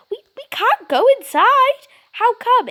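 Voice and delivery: put-on high, panicky child's protest; scared child. Frightened Jane refusing to enter the ruined house, high panicky voice. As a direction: squeak it out like a frightened little girl.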